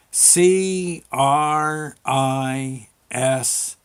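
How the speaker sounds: letters drawn out very slowly one by one; a child sounding out each letter; spelling it out. Retold or imitated.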